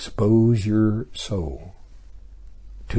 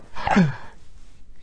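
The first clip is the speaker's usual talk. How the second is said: gasp; 8 semitones higher than usual. Pretend. Friends shocked at accent.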